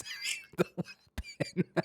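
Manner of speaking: soft mock whisper. Repeating the sign to the viewers as a hushed whisper. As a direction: breathe the words like a secret.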